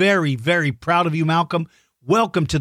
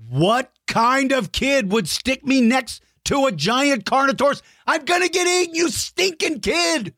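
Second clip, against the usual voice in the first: mock panic; 8 semitones higher than usual. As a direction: whine playfully about being eaten. Mock fear of Carnotaurus.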